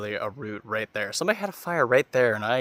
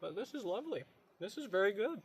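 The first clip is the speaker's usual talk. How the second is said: mouth full; 7 semitones higher, 12 dB quieter than usual. Real, not acted. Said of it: "Excuse me for talking with my mouth full".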